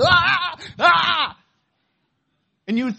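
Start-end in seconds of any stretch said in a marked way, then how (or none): 0.0-1.4 high, loud yelp of pain; acted pain scream. Pastor reenacting being stung by ground hornets, screaming. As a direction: cry out as if being stung over and over. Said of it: "I'm standing there going".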